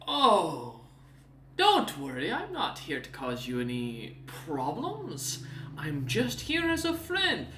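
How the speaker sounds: a hint of a smooth, smug demon; lightly voiced Snowbeard. Demon assures the party he comes as a friend, lightly voiced. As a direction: reassure them with an oily smile.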